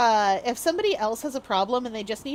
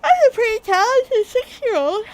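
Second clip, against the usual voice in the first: mock indignant squeak; 12 semitones higher, 9 dB louder than usual. Mock defensive reply.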